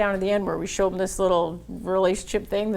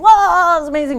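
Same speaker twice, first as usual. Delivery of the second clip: very high, much louder wow; amazed wow; 10 semitones higher, 11 dB louder than usual. Retold or imitated. Banker reacting to the demo, loud high amazed wow. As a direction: gasp in wonder at it.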